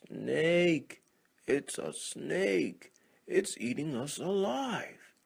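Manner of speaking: high, loud panicked screams; terrified frogs. Acted. Frogs flee the snake screaming in terror. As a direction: scream for your life.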